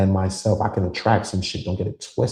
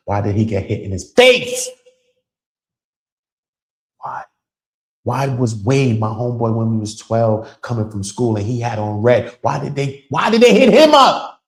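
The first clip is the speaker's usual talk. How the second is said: anguished cry; 3 semitones higher, 10 dB louder than usual. Real, not acted. Remembering murdered childhood friends, anguished building cry. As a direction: cry out why your friends died. Grieving friends killed young.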